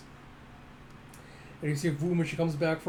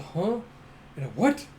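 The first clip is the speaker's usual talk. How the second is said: mock surprise; 4 semitones higher, 3 dB louder than usual. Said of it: "looks back in surprise".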